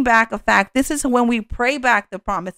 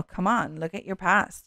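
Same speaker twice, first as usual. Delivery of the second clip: quiet, belittling aside; dismissive inner voice; 3 semitones lower, 7 dB quieter than usual. Acted. Little voice in your head running you down, dismissive. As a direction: drop the volume and brush them off. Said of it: "this little voice kinda tells you".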